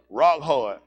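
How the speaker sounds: loud punchy punchline. Acted. Comic telling the crowd about biscuits, loud punch. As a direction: hit it hard and short.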